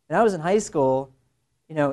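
normal talking voice

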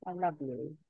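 soft, low and intimate; tender. Acted. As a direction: murmur it lovingly. Tender moment, smiling at partner.

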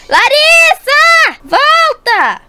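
high, drawn-out calling shout. Acted. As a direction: yell across the water to bring her back. Calls sister back from deep water.

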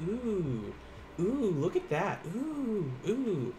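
"Ooh" as a drawn-out "oo"; Japanese vowel U. Shown as a demonstration. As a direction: hold "Ooh" long and pure. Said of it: "How do we say ooh?".

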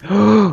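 loud startled gasp; sudden high gasp of fright; sharp gasp. Real, not acted. Zombie jumps player in game.

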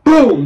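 loud, high boom; boom impact sound. Retold or imitated. Describing the tackle, loud boom. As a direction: slam out a boom.

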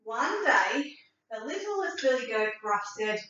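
ordinary delivery

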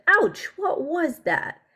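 high, startled ouch and question; a storybook character surprised by pain; mock ouch. Kid character yelps in surprise. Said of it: "She said".

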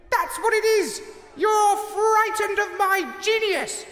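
high, far-off frantic shriek of a vain president; screaming president. Acted. President screaming from the tower at the mob outside the gates, high frantic shriek. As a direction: shriek it down at the mob like a wounded genius. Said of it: "screaming at the mob outside the gates".

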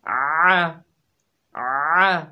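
आ squeezed from a pressed throat; आ with a tight throat; pressed-throat ain. Shown as a demonstration. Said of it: "गले को दबा कर पढ़ेंगे".

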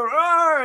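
answering arr, imitating; mock arr back. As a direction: toss back a gruff arr. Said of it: "then I go".

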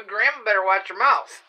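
loud, laughing mock warning; laughing scold. Grandma laughing as she warns the toddler. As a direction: scolds herself through a laugh. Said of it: "I said".

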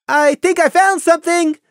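high, loud excited call. Acted. Young pony calling to the group, loud and excited. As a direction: call out the find eagerly. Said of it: "Scenic called".

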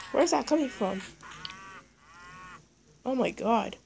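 ordinary delivery

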